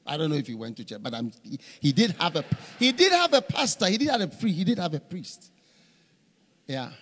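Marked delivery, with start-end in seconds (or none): none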